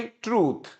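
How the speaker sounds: ट्रूथ repeated with held 'oo'; correct long vowel. Shown as a demonstration. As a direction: drill ट्रूथ as 'trooth', falling. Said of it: "इसको बोलते हैं ट्रूथ". (ट्रूथ, खींचे हुए 'ऊ' के साथ)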